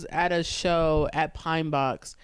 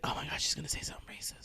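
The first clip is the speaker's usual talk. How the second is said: fast, hushed gossipy whisper; 2 semitones lower, 12 dB quieter, much faster than usual. Retold or imitated. Audience whispering to each other, fast hush. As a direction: whisper it quickly to a neighbor. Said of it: "And they'll be like".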